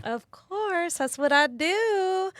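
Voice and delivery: high, drawn-out cutesy reply; playful sing-song. Real, not acted. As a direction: sing it back high, stretching the last word.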